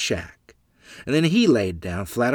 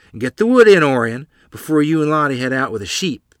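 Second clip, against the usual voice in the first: mother calling orders; 4 semitones higher, 7 dB louder than usual. Acted. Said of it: "Mother said".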